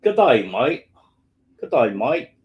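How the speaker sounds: hammed-up Australian greeting; Aussie accent. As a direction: put on a rough Aussie accent. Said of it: "your Aussie accent is rubbish".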